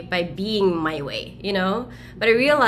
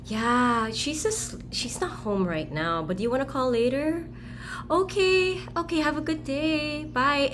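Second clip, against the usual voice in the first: high, lilting, melodic greeting; singsong phone voice; 6 semitones higher than usual. Retold or imitated. The girl answers the doctor's caller with a higher, singsong voice. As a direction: sing the words up and down sweetly. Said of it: "I would just go and sing".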